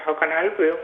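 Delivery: usual speaking voice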